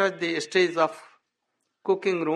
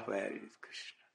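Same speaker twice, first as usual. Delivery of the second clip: the echo sinks away, much softer; fading weep; 6 semitones lower, 17 dB quieter than usual. Retold or imitated. Frogs weeping for Krishna, fading to a soft wail. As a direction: let the cry die into a sob-like murmur. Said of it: "weeping in sad".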